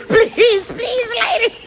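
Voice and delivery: high, shouted plea; begging man. Retold or imitated. A late man pleading at the boarding gate, shouted high. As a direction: cry out please like a frantic traveller.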